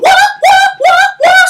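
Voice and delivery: high, sharp repeated whoops; whipping cries. Retold or imitated. Chelios whipping the guy to death, high sharp whip cries. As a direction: fire off high yelped whip noises.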